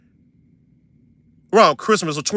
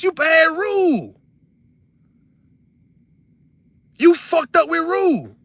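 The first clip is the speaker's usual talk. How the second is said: slow scolding; 10 semitones higher, much slower than usual. Pretend. Imagined scolding, drawn out.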